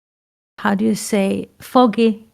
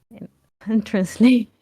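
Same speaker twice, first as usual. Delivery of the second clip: ordinary talk, nothing marked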